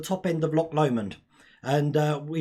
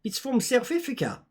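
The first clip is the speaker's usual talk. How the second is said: high, badly done South African accent; 6 semitones higher than usual. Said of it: "my accent's terrible".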